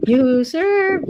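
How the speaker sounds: high cooing pet voice; sing-song call to a pet. Real, not acted. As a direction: coo sweetly at the dog. Cooing at the dog.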